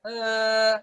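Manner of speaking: level sustained "eh"; flat held note. Shown as a demonstration. Teacher showing a clean voice, flat sustained note. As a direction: hold one even vowel without wavering.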